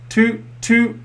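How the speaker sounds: high, sung-out toots like a boat horn. A narrator's reading. The boats tooting on the river, high horn imitation. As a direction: toot like a little tugboat. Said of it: "went Danny and the dinosaur".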